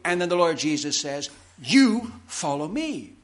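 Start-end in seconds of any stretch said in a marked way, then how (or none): 1.6-3.1 "You" drawn out and punched, pause before "follow me"; slow, higher command stressing "You"; emphatic. Retold or imitated. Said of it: "It's very emphatic".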